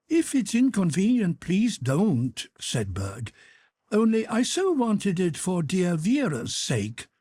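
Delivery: put-on an earnest young officer asking a favour; Berg's pleading voice. Berg pleading again.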